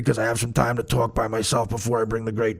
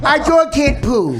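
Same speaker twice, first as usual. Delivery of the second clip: put-on much higher, slow whiny baby voice; mock baby talk; 12 semitones higher, 6 dB louder, slower than usual. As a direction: ham up the roommate's dog voice. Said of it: "you hear [name] go".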